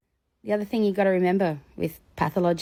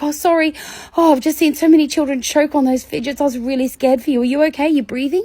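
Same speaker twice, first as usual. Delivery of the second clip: higher, louder fussing worry; mock concern; 8 semitones higher, 5 dB louder than usual. Speaker reassures the child with staged worried concern. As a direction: fuss over him with big concern. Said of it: "I was concerned for him".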